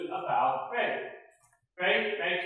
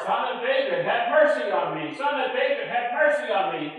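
higher, louder desperate cry; shouting all the more; 7 semitones higher, 8 dB louder than usual. Retold or imitated. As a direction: cry out over the crowd, pleading. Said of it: "He shouted all the more".

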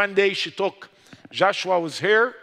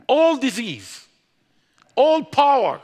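preaching climax; 7 semitones higher, 6 dB louder, slower than usual. Real, not acted.

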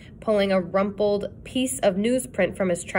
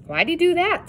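put-on eager boy; 9 semitones higher than usual.

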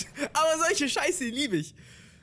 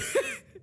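high giggle. Real, not acted. Absurd game moment.

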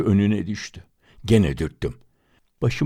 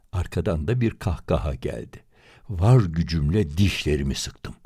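ordinary delivery, nothing marked

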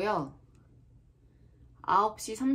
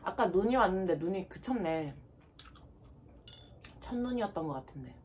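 talking between bites; muffled with food in mouth; mouth full, chewing; 3 semitones lower, 4 dB quieter than usual. Real, not acted. Seen 0: Bit fruit on skewer earlier; cheeks full, chewing while speaking.

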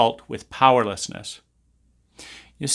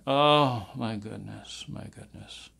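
fading to whisper; 2 semitones lower, 8 dB quieter, slower than usual. Acted.

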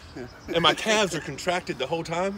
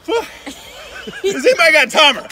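breathless, laughing, slow; strained laugh from the cold; in ice cold water; 11 semitones higher, 11 dB louder, slower than usual. Real, not acted.

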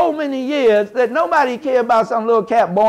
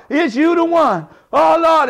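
hopeful plea; 7 semitones higher than usual. Retold or imitated. Ancestors asking the babies.

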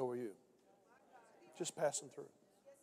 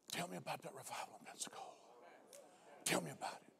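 whispered, urgent and secret; hushed question; 13 dB quieter, faster than usual. Retold or imitated. Congressman pulled aside in private, whispering his question. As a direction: lean in and whisper the request.

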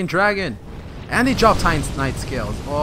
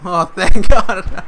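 relieved laugh; 3 semitones higher, 14 dB louder, much slower than usual. Real, not acted. Relief after killing Havel.

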